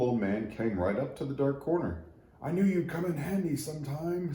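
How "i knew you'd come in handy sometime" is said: put-on high, cheerful old man voice; a kindly old carpenter pleased with his find; little old man. Old man greeting the forgotten tree, high and cheerful.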